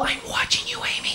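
whispered creepy phone stalker; soft, voiceless threat; menacing whisper. Acted. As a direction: whisper it down the line like a movie villain. Menacing caller phones the trailer heroine.

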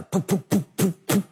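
short puffed engine pops; imitated engine sputter. Preacher mimicking an airplane engine cutting out, sputtering puffs. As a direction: sputter like a failing plane engine. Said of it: "you hear one engine sputter".

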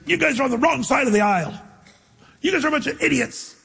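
high, sneering mock insult; mock insulting preacher. Preacher mocking a pagan city, high sneering voice. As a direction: play the scornful preacher calling names. Paul mocked as name-calling preacher.

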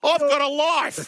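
high, drawn-out protest; indignant retort. Real, not acted. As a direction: protest it slowly, pitched right up. Indignant retort to teasing question.

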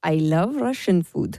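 low, slow Russian-accented line; attempted Russian accent. Shown as a demonstration. Asked to do an accent on air, attempted Russian accent. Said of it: "a bit more Russian".

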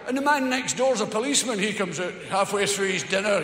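ordinary delivery

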